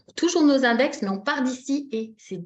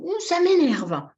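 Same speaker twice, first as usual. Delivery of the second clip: high, loud, drawn-out grumble; theatrical irritation; acted exasperation; 7 semitones higher, 6 dB louder, slower than usual. Shown as a demonstration. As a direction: stretch the words out in mock annoyance. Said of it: "c'est important d'être en accord aussi".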